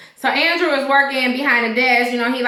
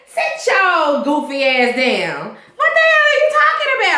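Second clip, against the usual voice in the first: much higher, incredulous, half-laughing rebuke; exasperated scolding; 9 semitones higher than usual. Pretend. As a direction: tell the character off like he's lost his mind. Viewer scolding the TV character.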